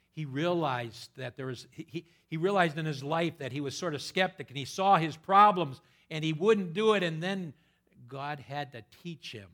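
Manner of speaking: preacher crying out about the skeptic Thomas; full-force preaching. Acted. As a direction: ring it out to the back pews. Preacher rises to a peak.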